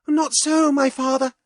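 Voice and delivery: high, clear interjection; a daughter stepping in; princess. A narrator's reading. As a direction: princess interrupting her father. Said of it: "said the Princess Helena".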